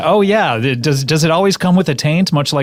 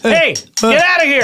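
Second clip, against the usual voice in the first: scaring off a bear; 11 semitones higher than usual. Pretend. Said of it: "how you would wake up a bear".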